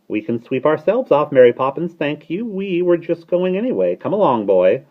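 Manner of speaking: high, loud starling retort; piping, self-important bird; huffy bird. A narrator's reading. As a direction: retort with haughty dignity.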